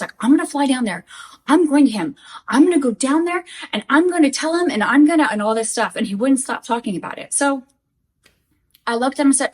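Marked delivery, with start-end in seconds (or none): 0.2-5.3 imitating worked-up threats, louder and higher as it builds; mock a livid rant, gathering force; livid retelling. Husband vows to confront his online rival, livid. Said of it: "He was livid".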